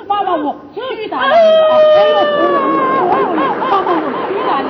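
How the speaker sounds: put-on high, loud crying child's wail. Child wails to mother. (孩子哭喊着找妈妈)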